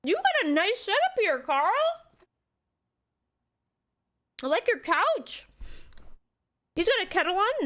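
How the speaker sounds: high, impressed visitor voice; admiring character voice. Acted. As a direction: play it admiring and chirpy. Acts game character praising Carl.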